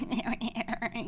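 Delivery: imitated smug snicker; put-on laugh of a cheeky brother; wise-guy laugh. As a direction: snicker as if pleased with a prank. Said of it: "like, a real wise guy".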